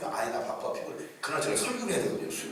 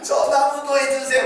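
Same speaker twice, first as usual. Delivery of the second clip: put-on excited child plea; 9 semitones higher, 11 dB louder than usual. Child begging again.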